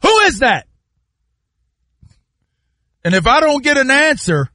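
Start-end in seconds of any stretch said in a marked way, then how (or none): 0.0-0.7 alert sleeper challenge. Retold or imitated. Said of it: "It could be all the way in the basement".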